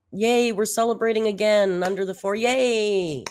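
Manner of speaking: sarcastic cheer. Acted. Reactor mocks the streamer's weigh-in celebration, sarcastic cheer. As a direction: cheer her on without meaning a word of it.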